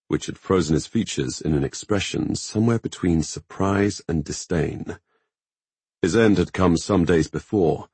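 usual speaking voice

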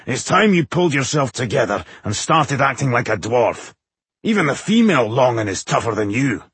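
gruff, scornful dwarf voice; harrumphing dwarf. A narrator's reading. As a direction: scold with a disdainful harrumph. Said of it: "Bowindil harumphed".

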